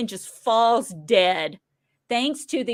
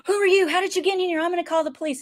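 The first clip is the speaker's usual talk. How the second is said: higher, startled demand; mock alarm; 7 semitones higher, much faster than usual. Kevin confronting a stranger in his house, higher and alarmed. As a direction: play the shocked homeowner.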